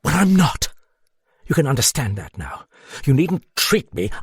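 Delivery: a touch of angry defiance; iron anger. A narrator's reading. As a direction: bite back at him, offended. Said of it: "She snarled the word with the iron anger".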